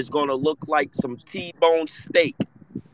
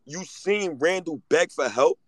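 ordinary talk

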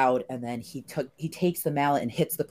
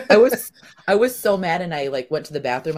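ordinary talk, nothing marked

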